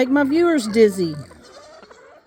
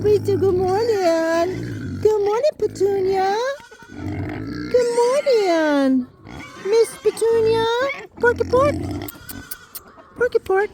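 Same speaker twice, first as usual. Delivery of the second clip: much higher, cooing greetings to the animals; high pet-talk voice; 9 semitones higher than usual. Real, not acted. Farmer greeting her pigs and animals in a high cooing voice. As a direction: coo good morning to each pet by name.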